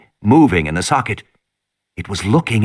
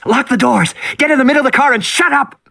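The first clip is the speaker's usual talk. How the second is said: urgent order; 13 semitones higher than usual. Acted. Tim ordering his sister.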